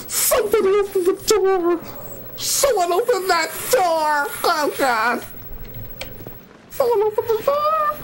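very high, tearful squealing; real panic. Real, not acted. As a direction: whimper and squeal in terror. Door opened, player panics.

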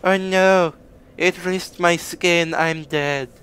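high-pitched mock lament; mock victim. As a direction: whine it as a hammed-up dying foe. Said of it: "He's like".

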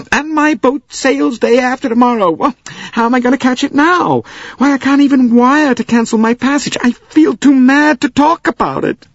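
high, loud wailing American lady. Acted. Missus Hubbard wailing to the passengers, high and loud.

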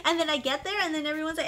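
normal talking voice

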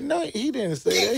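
laughing. Real, not acted. Laughing while correcting the chef.

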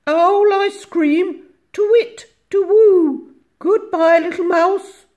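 very high, loud hooting voice; alarmed owl. A narrator's reading. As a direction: hoot in fright and flee. Alarmed owl flees in story.

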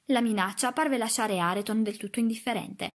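ordinary delivery, nothing marked

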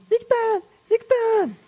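very high squeaky mock yell; high-pitched call without real volume; imitated distant yelling. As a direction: imitate a friend calling out, way up high. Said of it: "Era Cerecita gritándome".

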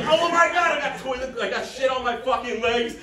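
high, frantic panicked voice; mock panic. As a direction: freak out about the mess. Panicked in bathroom.

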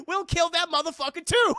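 very loud, high bravado; hammed-up gung-ho defiance; defiant shout. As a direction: yell it, punching the last word. Acting defiant soldier.

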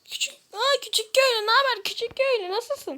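lifted, eager hello to a villager; excited greeting. Real, not acted. (heyecanlı selam)

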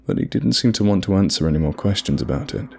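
ordinary delivery

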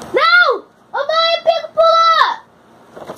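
high, loud shriek calling for mom; sudden high yell at the dog, real alarm; real panicked shout. Real, not acted. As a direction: yell for help as the dog jumps. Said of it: "Aí eu gritei aqui no vídeo".

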